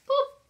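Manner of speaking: high little boop; a playful high boop as the page turns; boop sound. Acted. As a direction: pop a quick cartoon boop.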